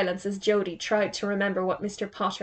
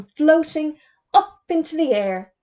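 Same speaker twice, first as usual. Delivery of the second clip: squeak of surprise; 7 semitones higher, 5 dB louder than usual. A narrator's reading. Teacher startled as he floats up, squeaking higher. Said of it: "His voice became a squeak of surprise".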